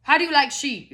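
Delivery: mock interrogation of Americans in a queue; pushy German guy. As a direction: quiz them like a pushy stranger. German guy quizzing Americans in line.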